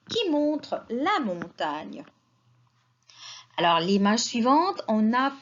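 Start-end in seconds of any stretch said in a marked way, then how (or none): none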